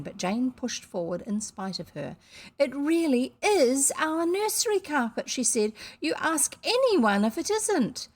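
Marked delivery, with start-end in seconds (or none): 2.6-8.1 put-on higher, louder child insisting; defiant insistence. A narrator's reading. Jane defiantly insists to Missus Biddle. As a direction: stand your ground as a child.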